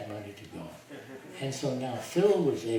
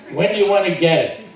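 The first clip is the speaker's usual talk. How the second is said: quick, higher, louder imitation of him; Harry Kenmore's way of talking; 7 semitones higher, 17 dB louder, faster than usual. Retelling Harry Kenmore's phone call, quick imitation. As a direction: rattle off his question like he did. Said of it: "this is the way he talked".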